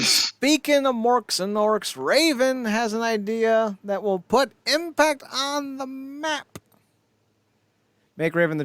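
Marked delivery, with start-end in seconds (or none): 0.0-6.6 higher, slow hammed-up announcer voice; mock announcer. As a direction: sell the segment like a showman announcer, drawn out. Mock segment intro.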